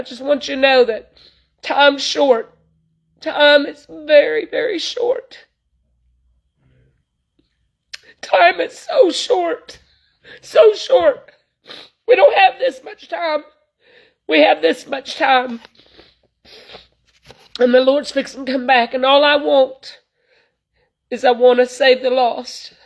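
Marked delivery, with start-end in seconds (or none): 3.2-15.6 higher, slow, tearful plea that time is so short; tearful pleading. Real, not acted. Preacher urging her listeners that time is short, tearful and slow. 18.9-22.7 crying. Real, not acted. Preacher telling listeners her wish to save the lost, crying.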